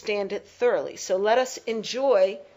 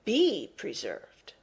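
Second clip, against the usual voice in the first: "be preserved" said slowly, with weight on "be"; stress on BE; 3 dB quieter, slower than usual. Shown as a demonstration. Teacher repeats the emphasized words, slowly stressing "be". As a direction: draw out "be preserved" and hit "be".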